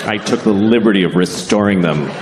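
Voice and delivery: mock-formal, superior delivery; condescending. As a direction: spell it out like a pedant. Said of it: "you're being a dick".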